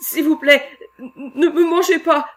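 terrified foreman begging the beast; pleading. Acted. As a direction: beg for his life. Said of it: "supplia Ole Swenson".

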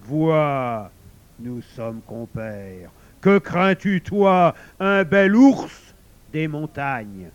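loud, drawn-out beastly swagger; rally a fellow beast with gusto; booming wolf. A narrator's reading. Wolf heartens the new bear. (loup tonitruant et fanfaron)

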